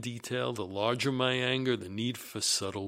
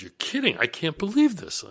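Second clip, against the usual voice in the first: raised-pitch retold disbelief; taken aback; 8 semitones higher, 5 dB louder than usual. Answering a friend's shocking news, taken aback. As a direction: act out being caught off guard. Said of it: "I was taken aback".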